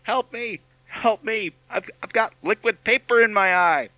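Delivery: high, desperate call for help; panicked cry for help. Acted. Victim cries out in commercial.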